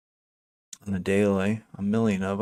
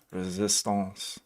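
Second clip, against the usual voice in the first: slower attempt at a French accent; 5 dB quieter, much slower than usual. Shown as a demonstration. Trader playfully repeating a chart term, in French accent. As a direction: draw the word out with a French accent. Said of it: "I like saying that with a French accent".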